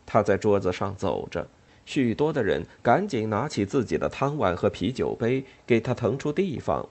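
plain reading voice

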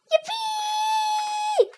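very high, loud yippee scream; joyful scream. A narrator's reading. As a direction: scream for joy. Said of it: "screamed Horn Henry".

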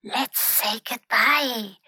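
soft, slow stage whisper; hushed and slow, for a friend's ears only. A narrator's reading. Pinky wants to leave the cabin door, whispering softly. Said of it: "whispered Pinky".

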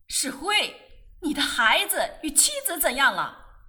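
loud, high scolding shout; sharp loud telling-off; loud rebuke. Acted. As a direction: bark the question at him. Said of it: "我大声喝他".

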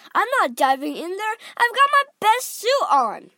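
high, loud protest; fussy husband objecting to his wife; indignant refusal. A narrator's reading.

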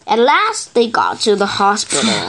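ordinary delivery, nothing marked

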